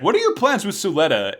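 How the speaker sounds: mock demand. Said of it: "she's like".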